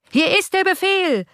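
mock him like a rowdy crowd; cheeky chorus. A narrator's reading. Hundred insolent voices answering the count, jeering. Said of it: "antworteten hundert freche Stimmen im Chor".

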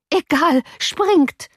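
very high, urgent cry; frantic command. A narrator's reading. Nurse desperate for master's escape, frantic command. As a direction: cry it out, climbing in pitch.

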